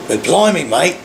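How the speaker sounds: a lightly hammed-up Aussie accent; mock Australian accent. Vlogger slipping into a joke Australian accent. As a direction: toss off blimey mate in a jokey Australian accent. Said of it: "That was more Australian".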